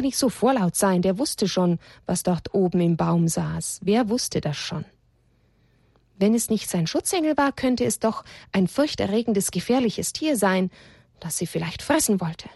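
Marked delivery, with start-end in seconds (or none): none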